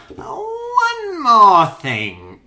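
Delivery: high, loud, very drawn-out catchphrase; Columbo. Acted. Friend doing a Columbo on request, hammed-up catchphrase. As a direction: stretch it slowly into a hammed-up detective impression. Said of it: "Do a Columbo".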